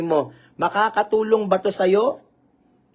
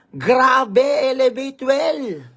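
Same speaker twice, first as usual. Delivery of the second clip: higher, amazed comment read out; mock viewer comment; 5 semitones higher, much slower than usual. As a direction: play an impressed viewer typing. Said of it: "Comment ka nga". (kunwaring namamanghang komento)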